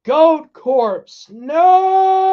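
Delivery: high, loud wail on a long held "no"; long, theatrical yell; drawn-out protest. Real, not acted. Protesting to a chatter.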